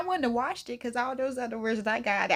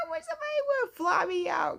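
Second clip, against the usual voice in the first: high-pitched mock shout; hammed-up excited cry. Mock wail about being flown out.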